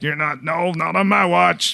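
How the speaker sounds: high, defiant Maritime woman; East Coast accent. Retold or imitated. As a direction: stand firm like a stubborn East Coast wife. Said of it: "So she's like".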